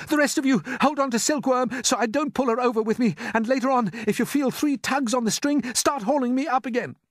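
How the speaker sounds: put-on high, loud boy giving orders; excited young hero taking charge; urgent instructions. A narrator's reading. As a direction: call out orders to the whole crew. James calls orders before jumping.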